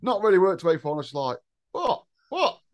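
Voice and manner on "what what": confused what. Retold or imitated. Young him confused in chip shop.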